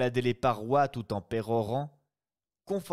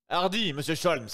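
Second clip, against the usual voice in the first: loud, higher shout from the boat; shouted encouragement; 6 semitones higher, 4 dB louder than usual. Acted. Brigadier to Sholmes swimming, shouting encouragement. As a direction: call out to spur him on. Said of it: "hurla le brigadier".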